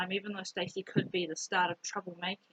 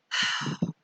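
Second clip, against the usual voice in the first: loud, breathy exhale; heavy sigh; 4 dB louder than usual. Real, not acted. Girl recalling her friend taking the blame, heavy sigh. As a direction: let out a big sigh mid-story.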